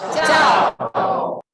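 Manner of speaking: Ciao called out loud to the room; shouted greeting. Real, not acted. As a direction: shout the hello back with energy. Host repeats greeting to audience.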